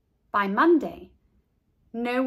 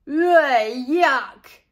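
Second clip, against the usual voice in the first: louder groan of disgust; loud yuck; disgusted boy; 4 semitones higher, 9 dB louder than usual. A narrator's reading. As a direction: recoil. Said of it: "said Buster".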